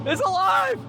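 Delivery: high, loud cry of triumph; triumphant shout. Real, not acted. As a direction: shout it like bringing a creature to life. Engine starts for first time.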